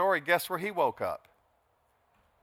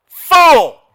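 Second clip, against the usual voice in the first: a massive shout of fool, much higher and louder; God thunders; 13 semitones higher, 20 dB louder than usual. Acted. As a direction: bellow it as God's judgment. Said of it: "But God said to him".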